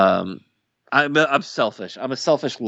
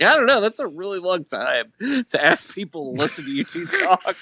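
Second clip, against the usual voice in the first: higher-pitched imitation of a sceptical woman; lightly put-on higher voice, doubtful; mildly mocking retold objection; 9 semitones higher than usual. Woman objecting to long podcast episodes, retold in a higher doubtful voice.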